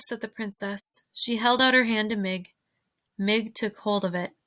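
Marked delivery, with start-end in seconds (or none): none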